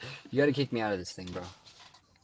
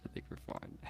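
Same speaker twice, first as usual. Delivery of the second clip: whispered aside; 11 dB quieter than usual. Real, not acted. Aside about encrypted site.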